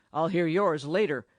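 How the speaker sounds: gruffly dismissing Dame Drusilla. A narrator's reading. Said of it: "said Dragonfell to the latter gruffly".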